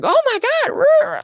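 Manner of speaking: high, loud startled cry; shocked shriek. Retold or imitated. Husband shrieking at a body in the road. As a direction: yelp it like you just saw something awful.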